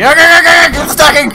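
frantic, high and loud; panicked yelling. Real, not acted. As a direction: shout it in a panic. Panicking as the extinguisher bursts.

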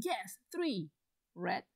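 ordinary talk, nothing marked

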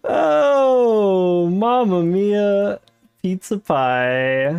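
hammed-up Italian chef, slow; mock Italian mamma mia; jolly italian pizza chef. Dismayed joke after a blunder.

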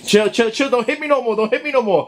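high-pitched plea to stop; high laughing plea. Real, not acted. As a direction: plead with her to stop hitting. Being hit after the reveal.

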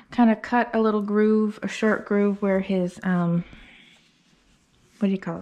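normal talking voice

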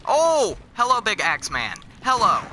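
high, loud startled greeting. Real, not acted. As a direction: jump in shock at the sight. Surprised by axe man.

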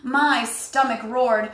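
plain reading voice

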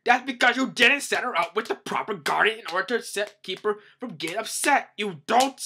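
loud, high, blustering blame; hammed-up exasperated ruler; acted angry outburst. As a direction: blow up at incompetent underlings. Voicing the king angrily blaming guards.

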